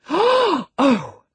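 high, loud outraged cry; gasp, then cry out in outrage; enraged gasp. A narrator's reading. Young lady too enraged to continue, exclaiming. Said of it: "exclaimed Catherine, too enraged to continue".

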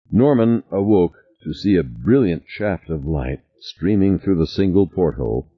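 normal talking voice

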